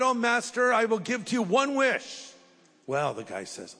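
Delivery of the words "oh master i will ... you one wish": high grand genie voice. Pretend. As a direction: boom it out like a genie granting wishes.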